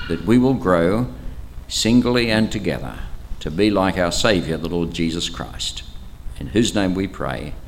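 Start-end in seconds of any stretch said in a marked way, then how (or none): none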